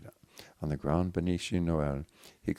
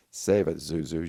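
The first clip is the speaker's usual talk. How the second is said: nonchalant; 7 dB louder than usual. Acted. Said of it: "with a nonchalant wave".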